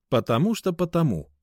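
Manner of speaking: loud, high retort; snappish. A narrator's reading. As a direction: snap back, refusing to explain. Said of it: "огрызнулся он". (огрызаясь, громко)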